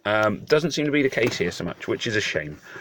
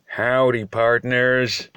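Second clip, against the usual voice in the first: a brief, tentative go at an American cowboy drawl; howdy like an American cowboy, half-joking; attempted American accent; 3 semitones higher, much slower than usual. Brit greeting his viewers with a mock American howdy. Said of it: "I'm gonna give it a brief go".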